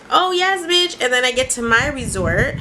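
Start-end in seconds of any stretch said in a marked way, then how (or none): 0.1-1.0 much higher, thrilled "oh yes"; delighted squeal. Retold or imitated. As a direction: squeal with delight at the pretty resort. Delighted by nice resort.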